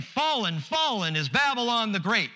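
mighty proclaiming cry. Acted. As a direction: ring it out like an angel announcing doom. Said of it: "with a mighty voice saying".

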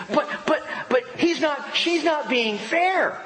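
put-on high, whining teen protest; whiny kid. Pastor mimicking a teen complaining about being treated unfairly, whiny high voice. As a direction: whine like a kid who feels wronged.

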